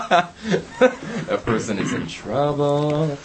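slow, high and laughing. Real, not acted. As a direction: laugh it out slowly. Laughing after finding apple.

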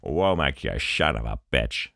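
low Scottish film-star voice, slurred s; Connery impression. Mimicking Mason confronting Womack, low Connery impression. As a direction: drop far down into a mock Scottish growl.

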